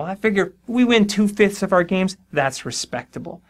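usual speaking voice

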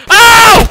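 loud high yelp of pain; sharp pained yelp. Real, not acted. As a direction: cry out as if hit. Hit in the game.

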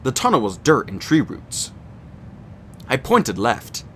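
ordinary delivery, nothing marked

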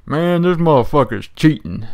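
slow imitated southern drawl, grumbling; mock the southern player in his accent. Imitating an angry southern free-for-all player, slow southern accent. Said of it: "like, a southern accent, a real deep voice".

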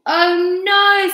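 high, loud dismay; exasperated bear. A narrator's reading. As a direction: wail as the sleepless bear. Clock keeps bear awake.

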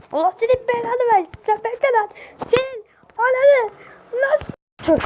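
high-pitched angry curse; angry cursing. Acted. Curses the fleeing shooter.

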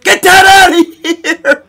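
real joyful shout; laughing shout. Real, not acted. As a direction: yell it again while cracking up. Repeated block celebration.

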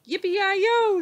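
loud, high triumphant yell; shouted. A narrator's reading. Leroy riding off on the pig, loud whoop. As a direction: holler it like a rodeo rider. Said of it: "shouted Leroy Ninker".

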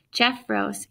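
ordinary delivery, nothing marked

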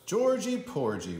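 ordinary delivery

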